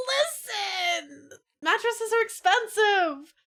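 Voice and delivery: high, slow indignant whine; mock-indignant protest. Real, not acted. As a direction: protest with playful outrage. Defending old mattress.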